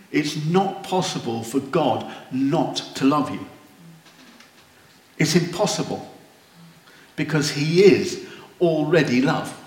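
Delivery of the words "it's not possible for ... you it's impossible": ordinary talk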